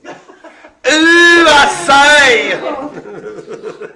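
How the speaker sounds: loud, high, drawn-out camp cry; hammed-up camp outrage, shrieked high; camp exclamation. Host camping it up for the players, loud camp shriek. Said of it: "that just sounded camp as Christmas".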